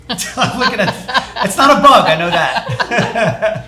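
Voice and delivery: high, laughing through the words. Real, not acted. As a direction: giggle while talking. Laughs at something on food.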